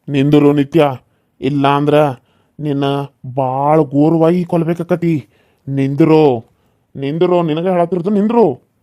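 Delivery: loud, slow threatening commands; menacing shout. Acted. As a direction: yell at her to stop or die. Villain orders fleeing girl to stop. (ಬೆದರಿಸುವ ಕೂಗು)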